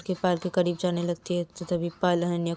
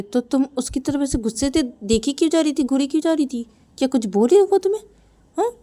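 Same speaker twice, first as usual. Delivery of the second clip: mother questioning; 7 semitones higher, 4 dB louder than usual. Acted. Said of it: "Ananya की mummy बोलती".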